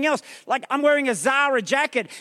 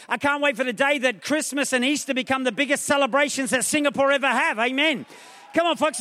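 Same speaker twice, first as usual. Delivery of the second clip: normal talking voice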